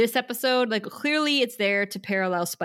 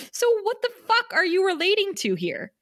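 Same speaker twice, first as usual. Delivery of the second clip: high-pitched incredulous demand; exasperated scolding; 11 semitones higher than usual. Acted. Host scolding Xander for relating to Nancy, exasperated. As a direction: call him out, pitch jumping up high. Mock scolding of a show character.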